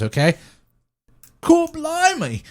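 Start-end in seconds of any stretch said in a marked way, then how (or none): 1.4-2.4 much higher, slow put-on voice, stretched out; imitating friend's dad. Joking with friends, trying to sound like your dad from Pickens, a high slow put-on voice. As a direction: ham up a goofy impression of a friend's dad. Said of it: "I'm gonna try and sound like your dad from Pickens".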